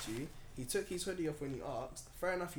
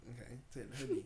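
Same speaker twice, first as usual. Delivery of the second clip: lower-key, softer blunt teen imitation; monotone teenage brother; 3 semitones lower, 7 dB quieter than usual. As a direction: hand it over in an unbothered monotone.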